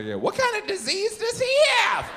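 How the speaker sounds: very high, loud mock onlooker voice; loud gawking. People gawking at a waiting customer, high and loud. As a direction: blare it out in a high put-on voice.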